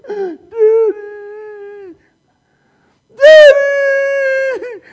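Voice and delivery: put-on high, loud, tearful child's wail calling daddy; scared child crying out. Little girl stuck atop the slide cries to daddy, high frightened wail.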